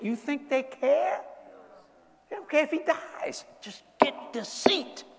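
high, slow sneering voice of the powerful; mock politician voice. Preacher mocking politicians who only want the Senate seat, high and slow.

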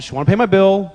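normal talking voice

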